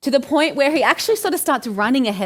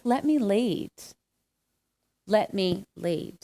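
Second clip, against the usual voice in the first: gentle whisper, retold; 3 semitones lower, 6 dB quieter, slower than usual. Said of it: "Jesus whispering to me".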